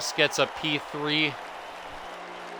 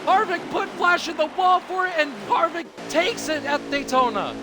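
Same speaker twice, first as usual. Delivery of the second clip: rising excited race call; motorsport commentator; 9 semitones higher than usual. Acted. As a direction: call the final lap, building hype.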